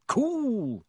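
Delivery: high, drawn-out admiration; impressed. A narrator's reading. Boy admires his roommates' stunt, impressed. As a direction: let it swoop down from high, impressed. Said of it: "said Dean".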